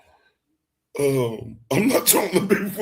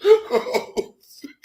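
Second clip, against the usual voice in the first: laughing; 4 dB louder than usual. Real, not acted. Listener cracking up replaying.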